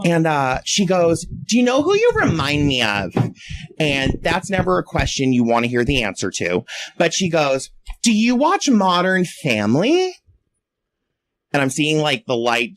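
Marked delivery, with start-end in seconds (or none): 1.5-3.1 high-pitched tipsy girl impression; imitated drunken curiosity, pitched up. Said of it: "this really drunk girl". 8.0-10.1 high, slow tipsy question; imitated drunken girl, rising at the end. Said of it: "this really drunk girl".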